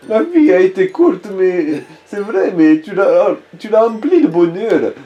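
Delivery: high, tender dying wife; Scarlett's voice. Acted. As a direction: read it as a loving wife's farewell. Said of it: "La lettre que Scarlett a laissée".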